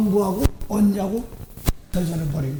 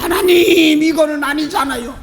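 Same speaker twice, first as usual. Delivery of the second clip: crying out to God; 6 semitones higher, 4 dB louder than usual. Retold or imitated. His protest to God retold.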